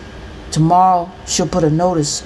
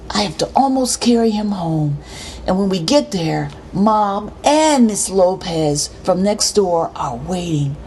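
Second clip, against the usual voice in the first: plain reading voice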